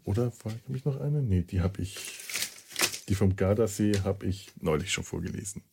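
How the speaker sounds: ordinary talk, nothing marked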